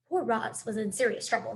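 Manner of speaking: ordinary delivery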